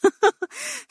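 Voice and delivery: high, short nervous laugh; a brittle, anxious laugh; tense laugh rising high. Acted. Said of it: "ضحكت ضحكة عصبية".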